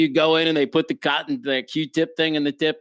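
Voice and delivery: usual speaking voice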